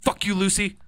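high, loud taunt while fleeing; defiant yell. Real, not acted. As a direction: yell it back at the ghost. Yelling at ghost while fleeing.

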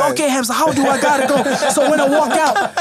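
high-pitched imitation of a nervous rapper; mimicking the rappers.

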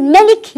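ملك said short, with no long alif after the meem; wrong short reading. Shown as a demonstration. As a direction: clip the vowel after the m in ملك. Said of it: "وهذا أيضًا غير صحيح".

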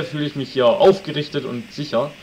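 ordinary talk, nothing marked

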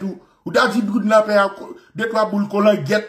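normal talking voice

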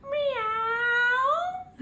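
high squeaky kitten meows; playful high cat call for a toddler. Shown as a demonstration. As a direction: meow like a little cat, high up. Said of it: "Go on, have a try".

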